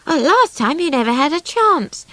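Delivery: quick eager outburst; excited. A narrator's reading. Swallow to the drifter, eager and excited. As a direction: blurt it out, thrilled. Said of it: "Swallow said excitedly".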